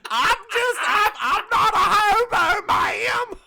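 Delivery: high, loud, half laughing and wailing; Ralph's voice. Acted. Host voicing Ralph pleading with the lady, high laughing wail. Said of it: "I'll give Ralph a voice".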